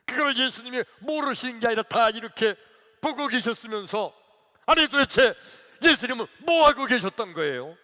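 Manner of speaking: loud, high acted outburst; defiant confrontation. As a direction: demand an answer from Jesus at full voice. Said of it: "그러니까 막 대들은 거예요". (대들듯 크고 높게 따지며)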